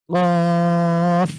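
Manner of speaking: কফ with the tongue root pushed hard against the back, held long; Arabic qaf with a push; punched qaf. Shown as a demonstration. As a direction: thrust the tongue root and hold কফ. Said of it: "তিন second long করব".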